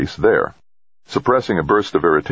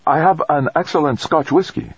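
bright, higher pitched offer; enthusiastic; 12 semitones higher than usual. A narrator's reading. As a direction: say it with eager delight. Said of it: "Fujita said enthusiastically".

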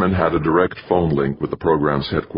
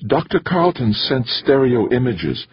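excited tremble; 9 semitones higher than usual. A narrator's reading. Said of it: "his voice trembling slightly with excitement".

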